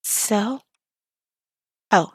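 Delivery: ordinary talk, nothing marked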